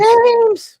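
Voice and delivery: loud, high, delighted shout of a name; excited greeting. Real, not acted. Greeting James as he rejoins the show, a loud happy shout. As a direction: call out to him, thrilled he's back. Greeting a friend who came back.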